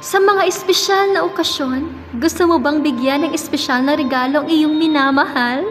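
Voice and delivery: lilting radio commercial voice; polished sales pitch with swinging intonation; ad read. Acted. Reads a ring advertisement.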